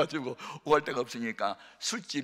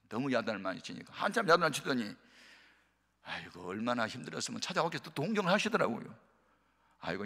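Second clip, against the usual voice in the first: ordinary delivery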